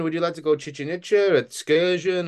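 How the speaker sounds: high, sing-song hawker pitch; street tout. Retold or imitated. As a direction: sell it like a resort tout. Tout selling excursions.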